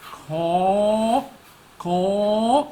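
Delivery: crow's call while strutting; crow caw. Acted. Crow demonstrating its walk.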